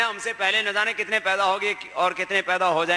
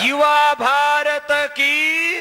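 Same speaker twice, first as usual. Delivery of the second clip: high, loud, drawn-out slogan call; stretched-out patriotic chant; rallying slogan; 5 semitones higher, 5 dB louder, much slower than usual. Real, not acted. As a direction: shout it out for the crowd to answer. Leads crowd in slogan.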